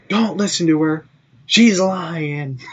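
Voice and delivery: high-pitched retold protest; high, insistent mock denial. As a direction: imitate pleading with everyone nearby. Retold protest to everyone in store.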